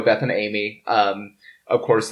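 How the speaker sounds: ordinary talk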